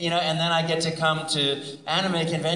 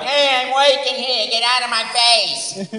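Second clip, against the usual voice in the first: loud, higher gruff Brooklyn Italian; Brooklyn plumber; 9 semitones higher, 8 dB louder than usual. Retold or imitated. Plumber shooing someone away, loud Brooklyn voice. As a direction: shove him off in a tough Brooklyn accent. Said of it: "Italian plumber from Brooklyn".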